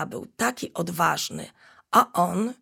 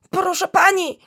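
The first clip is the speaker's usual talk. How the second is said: high, louder begging "Proszę pani"; pleading; 13 semitones higher, 7 dB louder than usual. Acted. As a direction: plead urgently with the teacher. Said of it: "spojrzał błagalnie".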